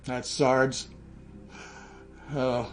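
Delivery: ordinary delivery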